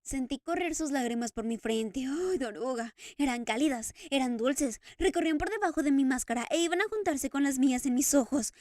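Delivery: almost pleading with joy; tearful Eric. Acted. Eric telling the Daroga of Christine's tears, overwhelmed.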